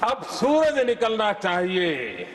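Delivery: slow, ringing recital with long-drawn words; poetry declamation. Acted. Politician reciting Neeraj's poem to the House, slow declamation. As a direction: declaim the verse, swelling on the key word.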